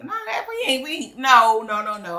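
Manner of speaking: high, loud, rapid protest; mock outraged refusal; emphatic no. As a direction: shoot the idea down hard, no no no. Rejecting husband's name idea.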